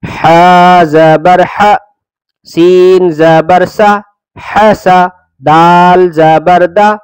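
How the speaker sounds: slow, drawn-out letter-by-letter chant; teacher reciting each syllable deliberately; spelling recitation. Acted. Teacher spelling the lesson for children, slow chant.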